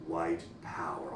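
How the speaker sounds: low, slow, hushed stage whisper; leaning in with a slow, low murmur; loud whisper. Retold or imitated. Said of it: "He whispers just a little bit too loudly".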